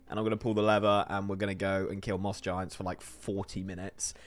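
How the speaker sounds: ordinary talk, nothing marked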